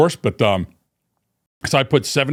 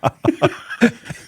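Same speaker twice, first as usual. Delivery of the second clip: high airy laugh; breathy laugh; 12 semitones higher than usual. Real, not acted. Laughing at story.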